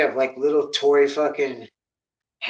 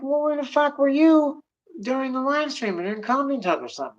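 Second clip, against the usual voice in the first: retort; 11 semitones higher than usual. Retold or imitated. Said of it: "And I'm like".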